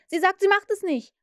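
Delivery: high, loud outraged shout; boy shouting in shock. A narrator's reading. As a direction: yell the news in dismay. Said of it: "schrie Nips".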